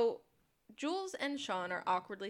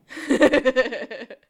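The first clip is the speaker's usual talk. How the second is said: big loud burst of laughter; 3 semitones higher, 18 dB louder than usual. Real, not acted. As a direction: crack up loudly. Laughing at own joke.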